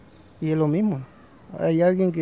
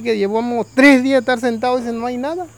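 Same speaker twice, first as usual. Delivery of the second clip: pleading; 7 semitones higher, 7 dB louder than usual. Acted. Hungry man begs swap back.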